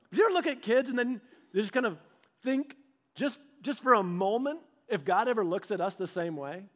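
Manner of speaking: ordinary delivery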